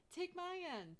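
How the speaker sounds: high, loud call to take the rope; ordering. A narrator's reading. Darlene orders Renee to take the rope end. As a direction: snap out an order to a friend. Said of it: "Darlene orders".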